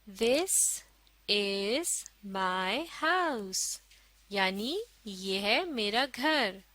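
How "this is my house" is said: very slow, word by word; slow model reading. Shown as a demonstration. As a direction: read it out slowly for learners to follow. Model reading for learners to follow. (धीरे-धीरे, एक-एक शब्द पढ़कर)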